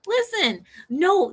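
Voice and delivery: ordinary talk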